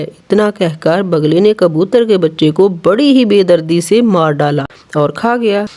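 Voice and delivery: ordinary delivery, nothing marked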